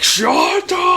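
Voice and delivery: high, drawn-out shout; excited call. Retold or imitated. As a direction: call out the name high and long. Endeavor calling out to his son.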